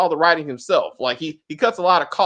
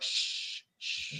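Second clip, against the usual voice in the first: hushing shh; 16 dB quieter than usual. Retold or imitated. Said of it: "I was like".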